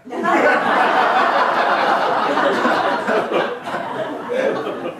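long airy laugh; high laugh. Real, not acted. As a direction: laugh hard. Teller laughing at nickname.

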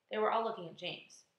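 ordinary delivery

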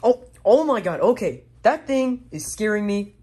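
loud, high, panicky cries; scared outburst. Acted. Spooked by the noise in the night, a loud scared outburst. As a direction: freak out at the scary noise.

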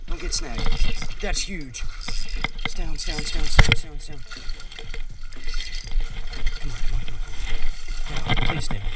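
normal talking voice

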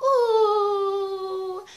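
long high "ooh" wail; mock wail. Reacting to her friend feeling bad, long mock wail.

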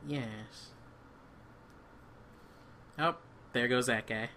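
ordinary talk, nothing marked

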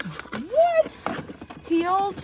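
high-pitched, astonished little squeal; excited disbelief. Real, not acted. Shopper spotting heels on sale, excited. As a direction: shoot the pitch up in delighted surprise.

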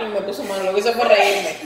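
usual speaking voice

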